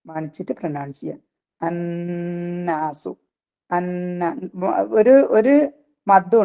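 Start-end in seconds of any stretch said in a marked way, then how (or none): 1.6-3.1 അന്നാസു chanted very slowly, the double n held through the nose; slow recited അന്നാസു with held ghunnah; nasal ghunnah held two counts. Shown as a demonstration. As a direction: hum the nn of അന്നാസു for two beats. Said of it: "രണ്ട് count മാനിച്ചിട്ട്".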